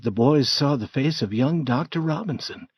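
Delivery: usual speaking voice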